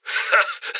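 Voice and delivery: quick breathy chuckle to himself; a pleased little laugh under the breath; short chuckle. Acted. Handler chuckles at progress.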